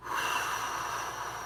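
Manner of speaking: soft unvoiced breath out; long calming exhale. Real, not acted. Telling himself to breathe, long soft exhale. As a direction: breathe out slowly to calm down. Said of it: "breathe in, breathe out".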